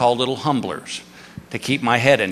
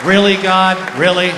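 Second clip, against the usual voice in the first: loud, higher-pitched disbelief aimed upward; exasperated appeal; 5 semitones higher, 5 dB louder than usual. Acted. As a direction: call God out in mock exasperation. Comic complaint to God after humbling.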